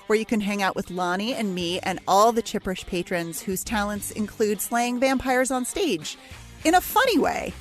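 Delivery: ordinary talk, nothing marked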